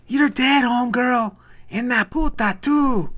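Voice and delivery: high loud threatening shout; angry yell. Acted. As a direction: bellow threats through the door. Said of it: "hear someone yell".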